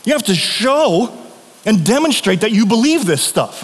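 lightly voiced neighbor laying it out; a hint of the earnest atheist neighbor; neighbor's voice. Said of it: "He's like".

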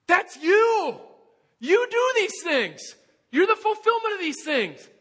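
high, loud and thrilled; excited recognition. Retold or imitated. Disciple reading Isaiah, realizing it's Jesus: loud excited cry. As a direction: burst out in amazed recognition. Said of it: "and then you're like".

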